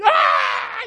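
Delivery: high, loud imitated scream; imitating a girl screaming before a spanking; mock child shriek. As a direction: shriek like a wailing kid.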